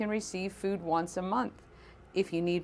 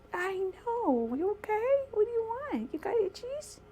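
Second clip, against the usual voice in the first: pet talk voice; 14 semitones higher, 4 dB quieter than usual. Real, not acted. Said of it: "my, uh, pet talk voice".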